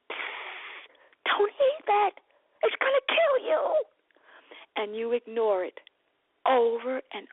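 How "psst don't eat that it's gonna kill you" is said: urgent warning. Retold or imitated. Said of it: "I, too, am saying".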